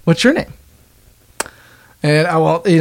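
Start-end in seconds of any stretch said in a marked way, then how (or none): 0.0-0.5 high-pitched pickup opener; acted friendly greeting. Man introducing himself at the keg, acted friendly greeting. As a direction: pitch it well up, like greeting a stranger. Acts out approaching someone to flirt.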